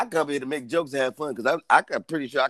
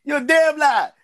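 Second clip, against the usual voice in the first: mock accusation; 9 semitones higher, 3 dB louder than usual. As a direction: needle them with a high-pitched charge. Mock-scolding Larry in banter.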